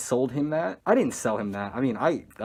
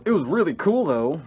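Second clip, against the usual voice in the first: lightly higher voice, lifting at the end; mildly put-on higher quote; imitating tripping friend; 4 semitones higher, 4 dB louder than usual. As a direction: mimic a friend's pleased afterthought. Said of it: "He goes".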